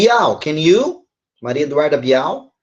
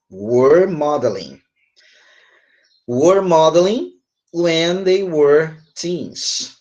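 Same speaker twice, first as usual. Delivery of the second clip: slow, deliberate teacher dictation; slow modelling; slower than usual. Shown as a demonstration. Teacher repeats the student's corrected answer, slow modelling. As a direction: draw out each word for learners.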